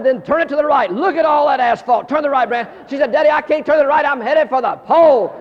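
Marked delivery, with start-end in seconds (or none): 0.0-2.7 high, very loud coaching dad; hollered directions. Retold or imitated. Father hollering directions to child. 3.1-5.3 high, very loud wail to Dad; child hollering. Retold or imitated. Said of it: "She started hollering".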